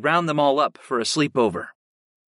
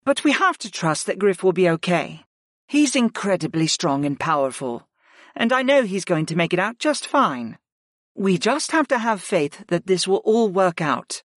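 higher, calm woman's voice; reassuring woman's voice; 6 semitones higher than usual. Acted. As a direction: soothe them as Arwen. Arwen reassures.

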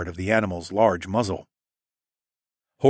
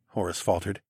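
usual speaking voice

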